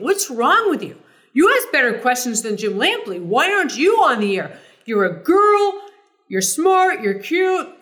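high, loud, brash tennis-star bark; John McEnroe's tone. Retold or imitated. McEnroe blurting praise at a reporter, loud and high. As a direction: belt it out like an indignant champ. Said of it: "you can hear that tone of John saying".

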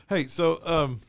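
normal talking voice

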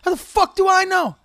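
high, loud, helpless indignation; exasperated outburst. Real, not acted. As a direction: throw up your hands and yell it. Exasperated rant about estimates.

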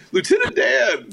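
high-pitched, star-struck cry; excited exclamation. Retold or imitated. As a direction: blurt it out high like a thrilled fan. Star-struck shout on seeing the actor.

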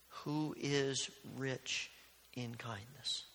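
very slowly, leaning on each word, rich in kindness; slow emphatic. Shown as a demonstration. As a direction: stretch out who is rich in kindness, soft and deliberate. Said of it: "It's really about God who's rich in kindness".

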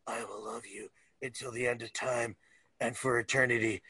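low, hushed mock-romantic vow; melodramatic movie lover. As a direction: parody a brooding declaration of eternal love. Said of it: "the movie itself was".

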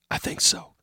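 soft unvoiced whisper. A narrator's reading. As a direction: breathe the answer. Whispered reply in hiding.

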